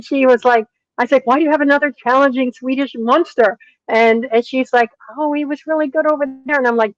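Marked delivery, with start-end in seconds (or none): 1.3-3.6 high, punchy incredulous question; retold exasperation. Trainer to friend with another challenging horse, exasperated. 5.2-6.6 high, quick defensive excuse; meek buyer. Retold or imitated. Buyer defending the horse from Sweden, meekly.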